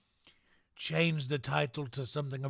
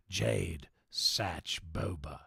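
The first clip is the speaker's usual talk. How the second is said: ordinary delivery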